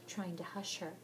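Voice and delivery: ordinary delivery, nothing marked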